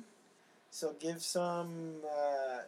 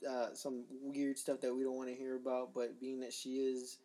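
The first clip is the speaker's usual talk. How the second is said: ordinary delivery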